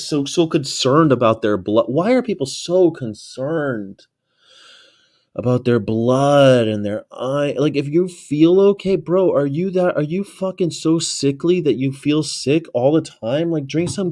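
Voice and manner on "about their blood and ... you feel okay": ordinary talk, nothing marked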